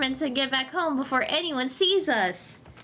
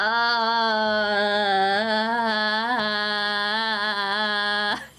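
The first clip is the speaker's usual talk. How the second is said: long evil laugh; 5 semitones lower than usual. Acted. Villain laughs.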